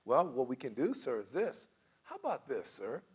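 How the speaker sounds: soft, patient, placating offer; calm de-escalating agent, gently suggesting; quiet, soothing service voice; good customer-service agent. Acted. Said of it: "So the good ones go".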